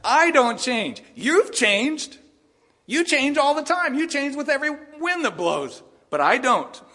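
loud and high, slow start; amused divine retort, grinning through it; God answering, laughing. Acted. God telling fickle Israel he never changes, loud and amused. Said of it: "God turns around and says".

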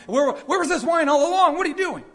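wedding master rounding on the groom; intense demand. Retold or imitated. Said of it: "An intense tone of voice".

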